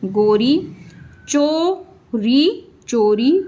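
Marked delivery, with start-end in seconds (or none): 1.3-2.7 slow sounding out. Shown as a demonstration. Teacher sounding out चोरी slowly for pupils. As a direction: stretch चोरी very slowly, rising at the end. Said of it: "ई का sound मिला इसमें".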